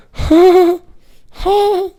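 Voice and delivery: very high falsetto mock laugh, imitating the roommate; stoned roommate's laugh. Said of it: "he'd be like".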